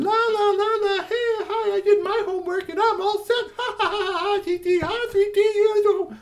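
very high, loud singsong taunt; teasing sister. A narrator's reading. Sister taunts brother over homework, teasing. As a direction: squeal it like a gloating little sister. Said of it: "she was teasing three T no end".

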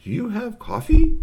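high, loud alien question; high-pitched coffee-craving creature; eager alien. Acted. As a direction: ask hopefully, voice shooting up.

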